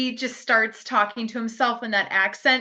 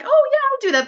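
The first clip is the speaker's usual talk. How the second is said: much higher, louder chirpy mock agreement; imitating Ava; 13 semitones higher, 5 dB louder, much faster than usual. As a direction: chirp it back as eager Ava. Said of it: "Ava's like".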